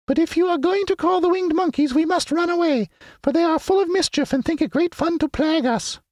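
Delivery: very high squeaky mouse queen; squeaky little voice. A narrator's reading. Queen of the Mice greeting her friends, squeaky little voice. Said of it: "in her squeaky little voice".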